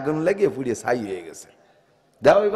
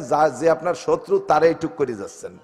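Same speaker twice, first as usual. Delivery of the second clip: ordinary talk, nothing marked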